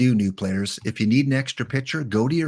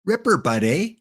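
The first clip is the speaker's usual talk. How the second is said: high-pitched mock Canadian accent; playful Canadian with a tagged-on eh; 5 semitones higher than usual. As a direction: put on a higher Canadian voice. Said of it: "That's more Canadian".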